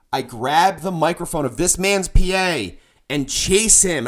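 ordinary delivery

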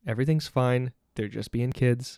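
low, soft self-reassurance; calming self-talk. Acted. Dad calming himself about screaming kids, softly reassuring. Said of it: "I have to tell myself".

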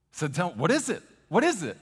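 high, loud excited plea; eager child asking. Retold or imitated. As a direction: beg like a curious little boy. Pastor acting eager son asking.

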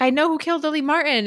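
mock-excited detective reveal; eager announcement. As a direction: blurt it out like a proud sleuth.

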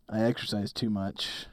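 low, hoarse sick voice. Real, not acted. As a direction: talk through a cold. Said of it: "I'm also a little sick, so if I sound like a dying yak".

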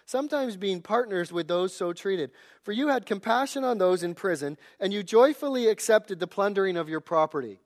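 loud, emphatic reading of the verse; forceful scripture reading. Acted. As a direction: proclaim the passage to the church.

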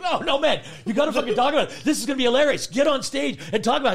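loud, high, insistent pep talk; excited urging. Retold or imitated. Friend urging him to get on stage, excited and insistent. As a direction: push him hard with gleeful insistence.

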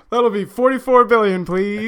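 loud, high cashier voice; mock salesman. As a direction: play a pushy seller asking for the money.

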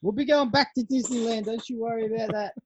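rowdy Cowboys cheer to his mate; fan hype. Acted. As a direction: belt it like a rowdy fan chant. Hyping Cowboys to the Super Bowl.

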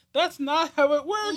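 high, slow disbelieving correction; incredulous high retort. Retold or imitated. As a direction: spell it out in disbelief. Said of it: "And I was like".

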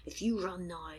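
hushed growly threat through clenched teeth, fading to a whisper; clenched-teeth talk. Retold or imitated. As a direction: imitate a boss hissing through locked teeth. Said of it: "clenched teeth talky thing".

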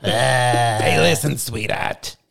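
lightly voiced old man, slow; a hint of an old New Yorker.

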